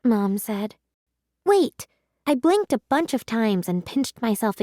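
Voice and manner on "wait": sudden wait. A narrator's reading. Girl protests as Cosmo changes.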